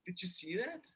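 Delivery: usual speaking voice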